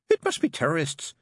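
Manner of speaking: high, irritated woman; snapped. A narrator's reading. Mary to intruder at her office door, snapping. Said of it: "she snapped".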